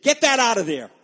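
acted-out brusque command; authoritative command. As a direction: order it moved, no argument allowed. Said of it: "very authoritatively".

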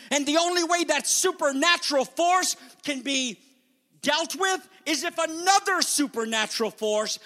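high, urgent pulpit delivery; forceful preaching. Real, not acted. Preacher warning of the devil blinding minds, forceful preaching. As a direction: press the warning home at full pitch.

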